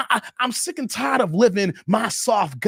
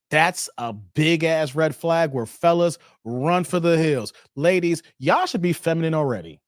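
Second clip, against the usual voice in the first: ordinary talk, nothing marked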